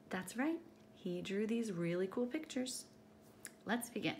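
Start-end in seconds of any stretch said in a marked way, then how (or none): none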